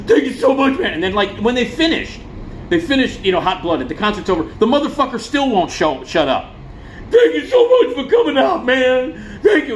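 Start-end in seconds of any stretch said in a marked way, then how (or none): 0.0-0.9 high-pitched blubbering thanks; imitating a weepy frontman; hammed-up tearful squeal; mock crying. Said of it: "he's crying". 7.1-9.8 high, loud blubbering thanks; hammed-up sobbing frontman squeal; mock crying. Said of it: "he's crying".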